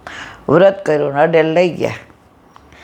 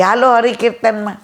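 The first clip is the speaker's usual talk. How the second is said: remember calling out to the neighbour, lightly; 7 semitones higher than usual. Retold or imitated. [name] recalling how she called her friend, mild high call. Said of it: "હું બૂમ પાડતી".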